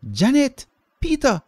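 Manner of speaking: very high, loud calls; shouting names. A narrator's reading. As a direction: call the kids from far away. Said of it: "she shouted".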